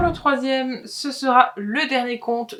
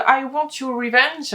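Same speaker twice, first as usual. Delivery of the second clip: book title in a put-on English accent, a touch higher and louder; 5 semitones higher, 4 dB louder than usual. Shown as a demonstration. Reading a book title to viewers, in a mock English accent. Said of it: "avec mon accent anglais".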